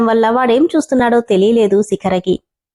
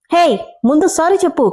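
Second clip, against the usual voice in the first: higher called-out demand; shouting from behind; 6 semitones higher than usual. A narrator's reading. Boy calls after the driver, higher mock shout. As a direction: call after him, pitched up, demanding. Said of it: "అని గట్టిగా అరిచాడు".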